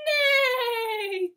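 neigh like a horse. Pretend. Imitating a horse.

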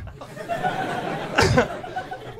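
a burst of high laughter. Real, not acted. Comic laughing at the baby name.

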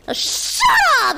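mock outburst. Shown as a demonstration. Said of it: "That's my real voice".

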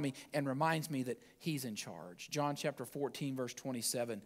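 low, hushed, gentle sermon voice; soft devotional preaching. Acted. Pastor comforting the congregation, hushed and gentle. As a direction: ease them into calm assurance.

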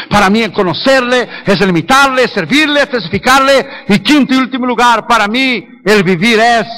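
high-pitched, emphatic list; fervent preaching. Real, not acted. Preacher listing what living for God means, fervent preaching.

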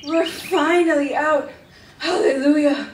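loud, high cheer; jubilant. Acted. Celebrating escape from the cave, jubilant. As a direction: shout in triumphant relief.

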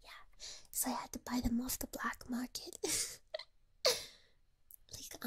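soft close whisper. Real, not acted. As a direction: whisper it like a secret. Whispers throughout.

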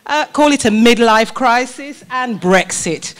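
ordinary talk, nothing marked

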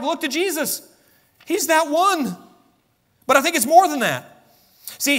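high, driving sermon voice; impassioned preaching. Real, not acted. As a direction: proclaim it with pitch well up. Preacher exalts Jesus.

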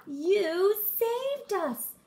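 shouted. Acted. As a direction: cry it out in relief. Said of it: "shouted the frogs".

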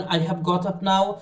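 slow, careful model line; slow demo. Shown as a demonstration. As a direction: say it slowly to practise. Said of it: "তখনই আপনি বলেন".

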